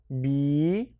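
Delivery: "bi" with a long held vowel; long syllable demo of "bi"; isolated syllable. Shown as a demonstration. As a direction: sound out "bi" by itself, drawn out. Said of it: "I have three syllables".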